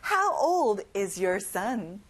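slow, clear question falling at the end; slow model question. Shown as a demonstration. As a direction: model it slowly for repetition. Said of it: "같이 따라해봐요". (천천히 따라하기 시범)

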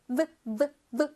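V as short separate buzzes, vuh; V sound drill. Shown as a demonstration. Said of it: "윗니로 아랫입술을 통통통 튀겨줘야 돼".

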